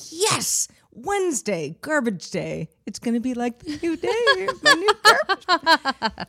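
high, rising squeal of delight, laughing through the end; giddy excitement. Retold or imitated. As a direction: act thrilled about garbage day. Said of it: "I'm like".

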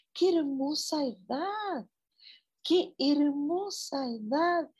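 high, slow, delighted cry of praise; delighted exclaiming. Real, not acted. Praising a listener's age with slow delight. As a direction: savour each word with joy.

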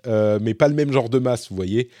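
normal talking voice

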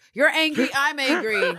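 high, loud, hammed-up protest; mock the dialogue with exaggerated anger; mocking recital. Podcaster sends up Eve's speech, hammed-up and angry.

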